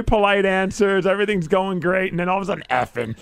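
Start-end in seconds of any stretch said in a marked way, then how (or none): 2.7-3.2 cracking up on the word effing; laughing. Real, not acted. Host recalling the guest's F-bomb, dissolving into laughter.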